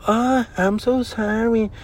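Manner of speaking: high, drawn-out mock apology; imitated apologetic customer. Woman customer realizing her mistake, exaggerated apologetic imitation.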